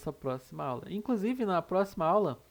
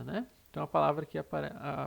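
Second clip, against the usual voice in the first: usual speaking voice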